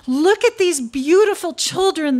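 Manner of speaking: high, loud proud mother; proud mother turtle exclaiming; turtle crying out proudly. Acted. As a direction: beam about your children. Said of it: "the turtle cried".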